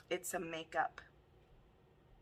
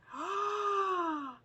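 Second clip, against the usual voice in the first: long, very high squealing gasp; ecstatic gasp; 13 semitones higher than usual. Real, not acted. Stunned by a new palette, ecstatic gasp. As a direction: suck in air with real delight.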